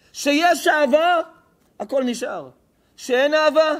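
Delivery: loud, high, driving preacher's cry; fiery sermon. Acted. Rabbi preaching to the crowd, loud and fervent. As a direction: thunder it at the congregation like a pulpit sermon.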